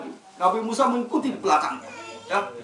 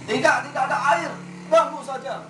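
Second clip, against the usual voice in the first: higher, faster brush-off; curt refusal; 6 semitones higher than usual. Acted. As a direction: snap them away quickly. Villager refusing water. (warga menolak dengan cepat dan ketus)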